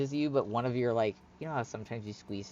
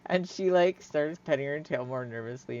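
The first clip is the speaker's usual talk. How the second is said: ordinary talk, nothing marked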